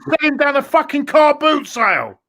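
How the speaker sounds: high, mocking contempt; scornful. Real, not acted. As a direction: raise it in disbelief at the player. Furious about Mustafi.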